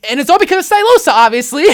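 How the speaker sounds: mock emphatic. Ironic over-the-top credit.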